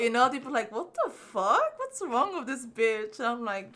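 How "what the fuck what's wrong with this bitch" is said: freaked out. Retold or imitated. Bullies reacting to her weird behavior, freaked out. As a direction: imitate bullies aghast, stretching the words. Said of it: "they'd be freaked out".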